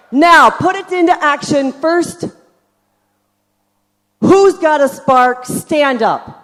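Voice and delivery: loud, calling to the crowd; yelling across the room. Real, not acted. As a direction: call people up from the stage. Calling audience up on stage.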